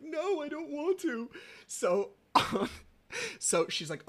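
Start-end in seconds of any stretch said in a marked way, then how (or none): 0.0-1.3 put-on high-pitched little boy pleading; whining child. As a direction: imitate a kid who wants out. Voicing his son refusing.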